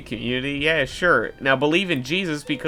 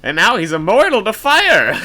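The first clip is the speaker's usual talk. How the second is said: mock movie explanation; 6 semitones higher, 10 dB louder than usual. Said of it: "and it was like".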